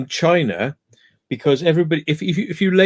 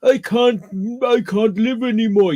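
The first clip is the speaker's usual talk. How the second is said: mock dying lament; 5 semitones higher than usual. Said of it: "pretend they're dying".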